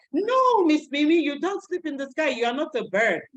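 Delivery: put-on high, loud child protest; kids' voice. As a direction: insist like a little kid. Voicing kids correcting her.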